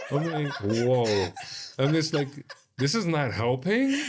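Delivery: high and laughing through the retold quip. Real, not acted. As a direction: giggle out the words. Retold reaction while laughing.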